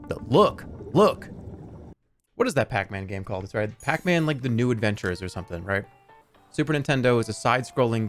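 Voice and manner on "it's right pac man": usual speaking voice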